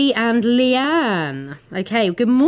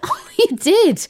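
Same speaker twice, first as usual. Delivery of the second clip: laughing tease; 10 semitones higher than usual. Real, not acted. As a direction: cackle at her friend. Teasing co-host who wet herself.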